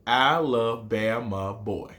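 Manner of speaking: put-on "Alabama boy" drawn out slowly; slow recitation. Shown as a demonstration. Teacher reciting his haiku's first line slowly. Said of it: "my haiku starts like this".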